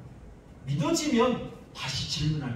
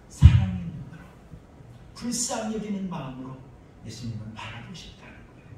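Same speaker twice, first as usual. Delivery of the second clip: ordinary talk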